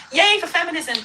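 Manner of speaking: high, loud "yay" in mockery; mock cheer. Mocking a woman's boasts about money, a high mock cheer. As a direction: cheer it loudly, insincerely.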